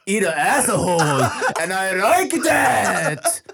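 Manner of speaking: high, laughing, drawn out; laughing mock review.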